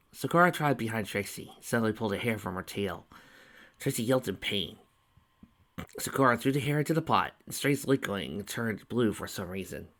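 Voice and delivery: usual speaking voice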